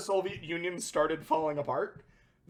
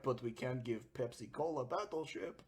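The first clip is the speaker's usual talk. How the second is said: much lower and softer, a broken-English mumble; mock low murmur; 7 semitones lower, 14 dB quieter than usual. Victor in the background grumbling to Gorbachev, low and soft. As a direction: grumble it from the back of the room.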